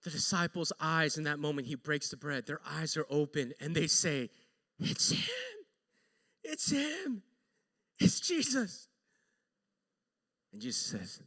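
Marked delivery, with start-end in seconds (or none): 4.8-8.9 high, hushed gasp of wonder; awed recognition. Retold or imitated. Disciples recognising Jesus as bread breaks, awed.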